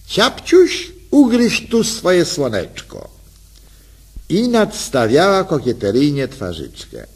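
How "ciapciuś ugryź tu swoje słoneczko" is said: high, coy, cooing wife's voice; coaxing call. Acted. Wife coaxing her husband to bite her, coy and high. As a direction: coax him sweetly like a flirt. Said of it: "zawołała kusząco".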